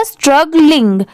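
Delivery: slowed repeat. Shown as a demonstration. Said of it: "तो पूरा क्या हो जाएगा".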